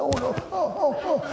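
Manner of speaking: high mock groans of an old man; creaky old man groan. Preacher mocking himself as frail, high hammed-up groaning. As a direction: ham up an aching old man. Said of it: "je me mette comme ça".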